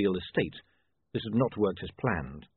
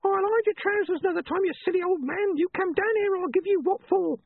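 very high, screechy nagging wife; high-pitched henpecking holler; scolding wife; 3 dB louder than usual. A narrator's reading. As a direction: call your husband down in a piercing high voice.

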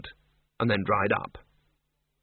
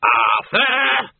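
loud, high screech of a name; scream; 12 semitones higher, 5 dB louder than usual. A narrator's reading. Doreen fending off a trolley, screaming at Arthur. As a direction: screech his name as an order. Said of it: "screamed Doreen".

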